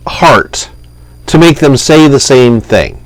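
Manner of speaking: usual speaking voice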